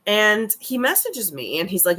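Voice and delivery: normal talking voice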